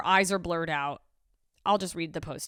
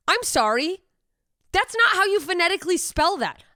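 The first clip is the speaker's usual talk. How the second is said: high, loud disbelief; incredulous protest; 9 semitones higher, 5 dB louder than usual. Real, not acted. Host disputing the phonetic spelling of foal, incredulous. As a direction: object loudly, voice jumping up.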